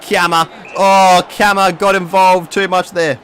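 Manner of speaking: a burst of real excitement; excited reaction. Real, not acted. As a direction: react loud to the play. Reacting to game play.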